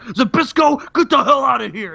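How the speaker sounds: a light imitation of a raging wrestler; mock angry shout. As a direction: shove him off with a roar. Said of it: "he's like".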